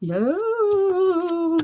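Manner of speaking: high drawn-out ghostly "No". Pretend. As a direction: moan it like a playful ghost. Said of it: "You sound ghostly".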